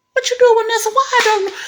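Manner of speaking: high, incredulous mock shout; mad, retold. Man learns she worked at NASA, retold mad shouting. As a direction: imitate a man demanding answers at a high pitch. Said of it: "But he mad".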